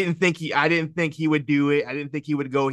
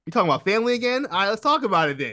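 imitating Cody; 7 semitones higher, faster than usual. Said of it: "Cody was like".

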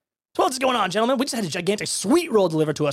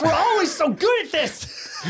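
slow, much higher, laughing 'We're always so good at this'; laughing sarcasm; 9 semitones higher, slower than usual. Acted. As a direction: drag it out while laughing at yourselves. Sarcastic repeat through laughter.

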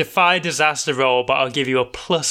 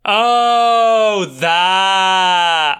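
a drawn-out, higher, louder "oh, that"; caught-out drawl; 4 semitones higher, 6 dB louder than usual. Acted. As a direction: stall like you've been caught.

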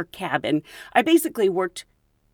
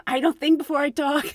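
mock sarcastic; 6 semitones higher than usual. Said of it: "I'm not gonna say that".

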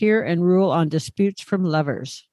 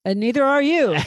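teasing shout; 7 semitones higher than usual. Real, not acted. Teasing punchline to the poem.

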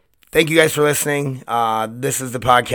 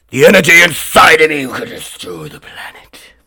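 loud, raised villain roar that falls away; supervillain outburst; 4 semitones higher, 9 dB louder than usual. Pretend. Host threatens to destroy the planet, supervillain outburst. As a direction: erupt like a comic-book supervillain. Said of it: "I sound like a supervillain".

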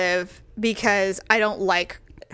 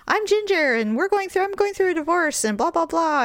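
high, sing-song impression of a chirpy neighbor; a mocking high voice for the neighbor introducing herself to the husband; 9 semitones higher than usual. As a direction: mimic the overly friendly new neighbor, pitched way up.

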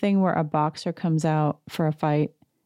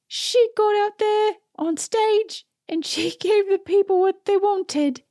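much higher, slow snooty mock Dorit; Dorit impression; slower than usual. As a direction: drawl it like a snob with a laugh. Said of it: "Dorit in her confessional says".

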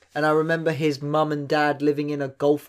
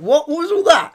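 high, louder incredulous question; mock outraged bafflement; 13 semitones higher, 6 dB louder than usual. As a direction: act out a bewildered sketch character, pitch shooting up. Acting out baffled policeman in sketch.

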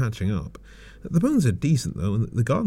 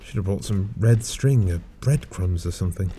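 usual speaking voice

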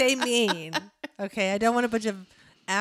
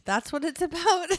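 mock-innocent surprise; 8 semitones higher than usual. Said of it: "Were you acting?".

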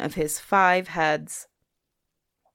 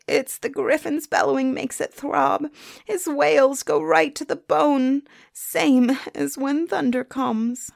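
a grandmother moaning about her throbbing leg; old grandmother in pain; 7 semitones higher, 3 dB louder than usual. A narrator's reading. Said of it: "old grandmother was just sobbing with the pain".

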